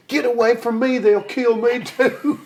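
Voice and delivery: high, frantic, laughing cry to get away; panicked plea. Retold or imitated. Drummer begging the singer to get away, high and panicked. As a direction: yelp it like the crowd's coming for you.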